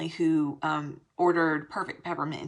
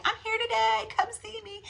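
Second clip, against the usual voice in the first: bright high sing-song invite, much higher; chirpy announcement. Retold or imitated. Chirpy invite to friends to come see her at the event. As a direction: sound like a cheery post calling friends over. Quoting her own social post.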